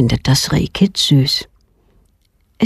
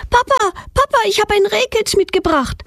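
put-on much higher, eager child calling; excited call. A narrator's reading. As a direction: call out to Papa bursting with news. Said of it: "schreit Petronella mit aufgeregter Stimme". (aufgeregt und viel höher gerufen)

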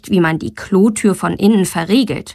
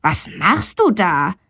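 lightly voiced boy; 2 semitones lower than usual. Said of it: "fragt er".

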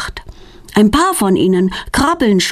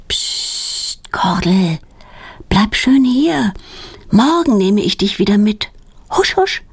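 mild whisper; 4 semitones higher than usual. Acted. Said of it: "flüstert Petronella".

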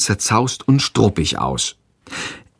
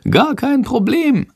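breezy little bat, high and drawn out. A narrator's reading. Bat answers the boy's praise, high and breezy. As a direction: answer in a high, slow, cocky creature voice. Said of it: "antwortet Shandor".

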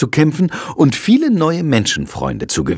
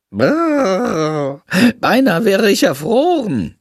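high bat voice, hammed-up shiver; exaggerated shivering; 7 semitones higher, slower than usual. Bat exaggerating how cold he got, hammed-up shivering. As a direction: overplay freezing like a drama queen. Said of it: "übertreibt Shandor".

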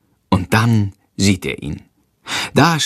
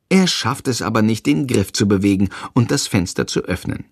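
ordinary delivery

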